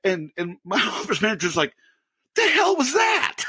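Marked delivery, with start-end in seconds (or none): 2.4-3.4 high-pitched shout of disbelief; stunned, high retold outcry; mock shocked yell. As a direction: imitate a startled coworker, pitched way up. Startled office manager reacts to scream.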